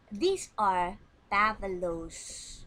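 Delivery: slow, loud modelled answer. Shown as a demonstration. Teacher modelling the plural answer, slow and loud. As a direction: lean on These for the plural. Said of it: "pakainya are".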